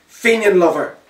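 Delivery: loud high angry yell; angry shout. Acted. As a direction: hurl it at him as an insult. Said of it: "one of the men shouted at me".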